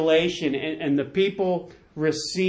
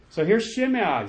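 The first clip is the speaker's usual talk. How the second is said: ordinary talk, nothing marked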